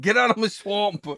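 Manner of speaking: loud, high-pitched Scottish ogre holler; Shrek. Acted. Co-host obliges with Shrek accent, indignant Scottish bellow. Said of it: "Just do a Shrek accent".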